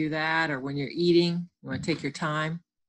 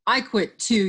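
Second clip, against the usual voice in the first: raised storybook yell; higher, louder huffy retort; 4 semitones higher, 5 dB louder than usual. Acted. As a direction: fire back loudly in a quarrel. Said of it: "yelled Alf".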